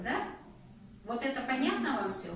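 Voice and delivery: ordinary talk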